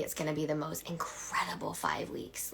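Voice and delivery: breathy whisper, hushed and eager; whispered hype. Real, not acted. Coach whispering about the five weeks to viewers. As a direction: whisper the promise like a secret.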